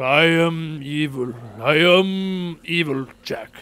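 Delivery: slow, hammed-up villain claim; mock evil. Answering chat about looking evil, slow mock-villain. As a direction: savour each word like a cartoon villain. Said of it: "Crojack shirts makes you look evil".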